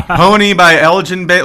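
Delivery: announcer-like mock credit. Co-host naming the hit song by its singer, hammed-up. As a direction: play up the singer's name.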